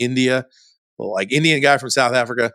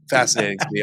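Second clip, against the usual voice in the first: ordinary talk, nothing marked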